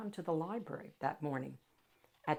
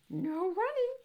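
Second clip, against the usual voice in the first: calling after; 14 semitones higher, 3 dB louder than usual. A narrator's reading. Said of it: "called after him".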